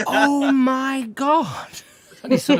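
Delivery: slow, high, loud amazed gasp of a line; astonished. Retold or imitated. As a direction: stretch it out, the boss suddenly gets the joke. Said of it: "And he went".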